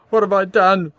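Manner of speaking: high, loud anguished cry. A narrator's reading. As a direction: cry out in pain and protest. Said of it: "Warwick cried".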